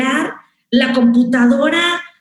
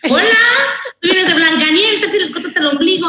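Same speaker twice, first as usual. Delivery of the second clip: teasing; 7 semitones higher than usual. Retold or imitated. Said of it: "al aire decíamos".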